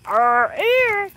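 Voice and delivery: high, slow, spooked "Oh, eerie". Retold or imitated. Spooked by a strange noise.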